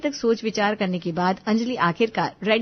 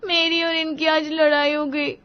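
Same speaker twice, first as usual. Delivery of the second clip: high-pitched, weepy confession; tearful; 8 semitones higher than usual. A narrator's reading. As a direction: admit it through tears. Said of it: "ऐसे रोता हुआ देखकर". (रुआँसी, ऊँची आवाज़ में बताना)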